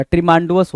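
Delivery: ट्रीमांडुअस as "tree-maan-du-us"; misspelling read out. Shown as a demonstration. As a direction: give ट्रीमांडुअस a long "maan" and extra "u". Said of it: "वो गड़बड़ हो गया".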